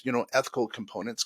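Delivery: usual speaking voice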